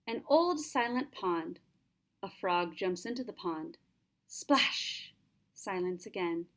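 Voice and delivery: measured, gentle haiku recital; poem reading. Acted. Teacher reads a haiku to the class in a calm recital. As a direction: read the poem as a little picture.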